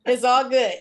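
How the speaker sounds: laughing it out. Real, not acted. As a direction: laugh through the words.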